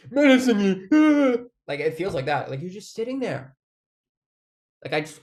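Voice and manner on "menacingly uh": dramatic emphasis. Retold or imitated. Self-correction, dramatic word.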